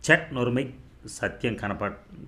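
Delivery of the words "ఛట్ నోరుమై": a sudden higher scold; sharp scolding. A narrator's reading. Father scolds son for a shameful remark, sharp scolding. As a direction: snap at the boy to shut up. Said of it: "కసరటం".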